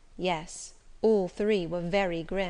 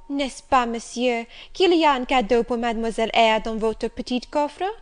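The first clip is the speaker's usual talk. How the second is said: put-on loud, raised child's plea in French; crying out; 3 semitones higher, 7 dB louder than usual. A narrator's reading. Adele begs the master for a present, crying out. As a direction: cry it out as an eager request. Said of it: "cried out".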